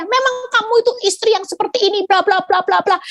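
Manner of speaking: high, rapid imitation of a ranting husband; high-pitched tirade running into blah-blah; mock scolding. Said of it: "dia malah makin maki saya".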